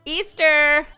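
high, loud, proud announcement; mock triumphant cheer. Player voicing her sim singing Easter, loud and proud. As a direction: trumpet it out like a fanfare. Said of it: "She's like".

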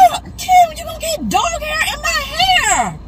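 high, slow whine; 1 whiny complaint. Real, not acted. Sister whining to Kim about dog hair, high and slow. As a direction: drag out the complaint, pitched high.

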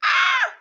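very high, loud yelp; startled scream. Real, not acted. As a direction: scream in sudden fright. Startled by supposed Arctic Wolf.

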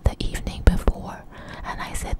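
plain reading voice

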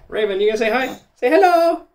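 high, loud sing-song hello; cheery prompt. Real, not acted. Mum showing her kids how to greet viewers, high and loud.

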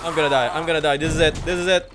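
loud frantic panic; panicked yelling. Real, not acted. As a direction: yell it in a panic. Chased by monsters.

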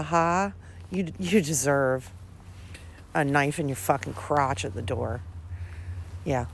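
normal talking voice

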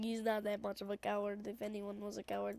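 ordinary talk

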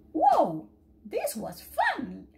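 loud whoa, then slow high glee; delighted. Acted. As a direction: splash about with joy. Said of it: "cried Dylan".

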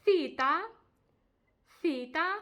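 cita with a lisped th at the start; Spanish c as th. Shown as a demonstration. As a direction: say cita as thita, tongue between the teeth. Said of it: "Наприклад, у таких словах".

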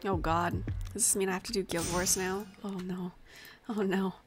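normal talking voice

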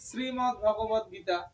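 শ্রীমদ্ ভগবত গীতা said slowly, naming the শ্রী sound; slow careful reading. Shown as a demonstration. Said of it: "শ্রী যখন আমি বলবো জিহ্বাটা কিন্তু উল্টে যাচ্ছে না". (ধীরে স্পষ্ট উচ্চারণ)

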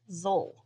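"zol" with no E at the end; one falling syllable, "zol"; silent E. Shown as a demonstration. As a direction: say "zol", ending on the l. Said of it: "you don't hear that E in there".

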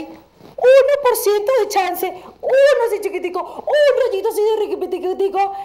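high, loud squeal; comic high-pitched emphasis on smallness; exaggerated tininess. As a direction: squeeze it up high and stretch it out. Voices a tiny sliver of hope.

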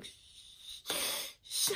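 hushed, voiceless shush; urgent shushing. Acted. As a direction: shush a crying baby in desperation. Mother shushing her crying baby.

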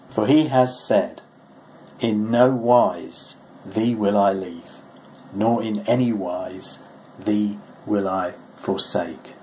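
slow, weighty scripture reading; deliberate emphatic recitation. Real, not acted. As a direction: read each phrase slowly and firmly, as a promise. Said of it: "It's pretty emphatic".

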